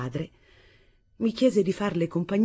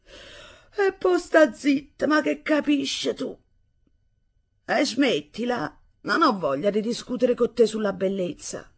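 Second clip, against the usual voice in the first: yawning; 7 semitones higher than usual. Acted. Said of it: "Mio padre sbadigliò".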